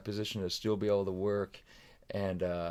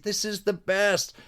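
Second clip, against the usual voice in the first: high, slow, savoring delight; blissful delight; 10 semitones higher, slower than usual. Retold or imitated. Dad's first day away on tour, acting out bliss. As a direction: draw it out in mock bliss. Acted joy at leaving town.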